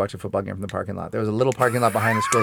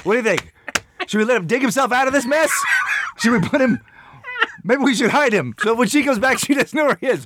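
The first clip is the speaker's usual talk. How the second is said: put-on much higher, eager child voice, taunting; mocking kid voice; 9 semitones higher than usual. Son mock-asking his dad where to put the crying toddler, high and giddy. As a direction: play the cheeky little kid egging dad on. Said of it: "And then Finn goes".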